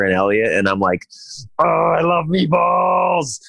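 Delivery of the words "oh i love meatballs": much higher, slow, drawn-out cry of delight; fired-up excitement. Retold or imitated. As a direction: gush over the meatballs like a kid. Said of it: "I was so fired up about these meatballs".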